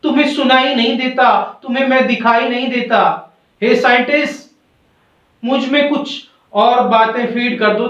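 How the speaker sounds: high, urgent acted pleading; storybook cry of helpless protest. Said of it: "अंतरात्मा चिल्लाती है".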